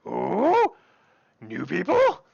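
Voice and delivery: curious orc, rising at the end; orc cook character. Acted. Orc in a chef's hat spots newcomers, curious rising voice. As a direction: coo with interest, swooping up. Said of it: "you hear from the corner".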